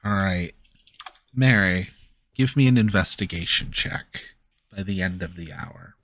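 usual speaking voice

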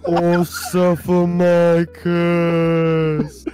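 mock curse voice. Friend mocks the cartoon villain, slow spooky curse. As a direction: drag out a hammed-up ancient curse.

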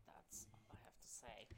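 whispered aside; whispering. Real, not acted. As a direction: whisper it under breath. Trailing off into aside.

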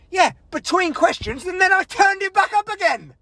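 high, exasperated comeback; worked-up self-defence; indignant retort. Retold or imitated. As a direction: protest it with rising pitch. Acted retort to manager's complaint.

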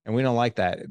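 normal talking voice

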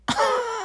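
sudden high squeal of laughter; high shrieking laugh. Real, not acted. Reacting to the ex's comment, a high shriek of laughter. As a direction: burst out in a shrill laugh.

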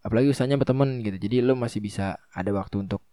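normal talking voice

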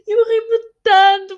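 laughing so hard the words squeak out high; breathless real laugh; real laughter. Real, not acted. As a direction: crack up mid-sentence, slowly.

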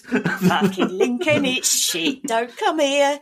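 mock broadcast, punching each phrase; mock radio jingle. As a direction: parody a local radio jingle. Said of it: "I absolutely recorded a radio jingle".

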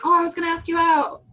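loud, high mock pickup line; mocking a smarmy guy. Imitating a reality TV guy, loud and high. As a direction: ham up the fake-casual offer. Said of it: "he's like".

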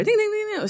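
high sing-song ding ding; doorbell imitation. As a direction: chime it like a doorbell. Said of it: "imitates doorbell".

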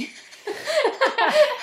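bright burst of high laughter. Real, not acted. Laughing at her friend's giver joke, high laugh.

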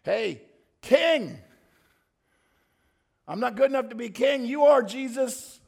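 loud, high call to a king; proclaiming. Acted. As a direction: proclaim his kingship at full voice. Beggar proclaiming Jesus as king.

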